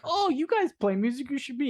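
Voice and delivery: high-pitched imitation of keen relatives; retold eager suggestion. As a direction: pitch it way up, mock enthusiasm.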